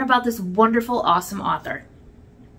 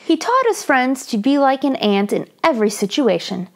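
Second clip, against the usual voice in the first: put-on lively read-aloud for children; story narration; 4 semitones higher, 5 dB louder than usual. A narrator's reading. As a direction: tell it brightly to young listeners.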